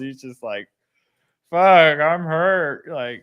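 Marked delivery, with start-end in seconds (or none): 1.5-2.9 mock-wounded kid, high and loud; hurt young son. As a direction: lightly imitate a heartsick boy blurting it out. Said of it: "don't even know how he feels".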